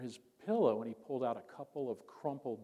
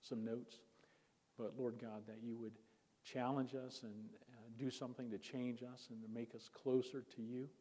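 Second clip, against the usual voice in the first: soft, hushed prayer voice; 5 dB quieter than usual. Real, not acted. Preacher praying with the congregation before the sermon, soft prayer. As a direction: pray quietly and earnestly.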